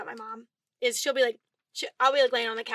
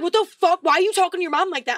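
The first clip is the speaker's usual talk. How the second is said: loud, raised-pitch scolding dad; imitated dad yelling; 5 semitones higher, 6 dB louder, faster than usual. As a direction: burst in yelling, imitating an angry father. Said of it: "he comes in yelling at me".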